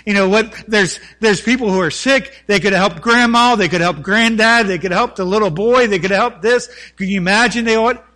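loud, rising sermon delivery; fervent preaching. Acted. Preacher pleading with his church, loud and impassioned. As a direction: pastor urging the congregation, preaching loud and fervent.

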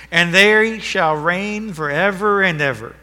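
ringing pulpit proclamation; preaching at full force. Acted. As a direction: declare it to the whole church. Proclaims verse.

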